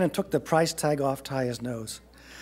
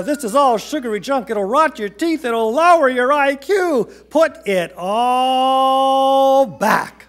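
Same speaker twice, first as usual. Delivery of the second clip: loud, high rant building to a long bellow; fed-up dad, stretching the final order out; exasperated scolding; 8 semitones higher, 6 dB louder, slower than usual. A narrator's reading. Father scolds, orders treats put back.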